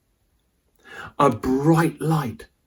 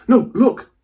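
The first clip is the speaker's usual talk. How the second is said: urgent warning; 10 semitones higher, 6 dB louder than usual. Acted. Warning of the alligator.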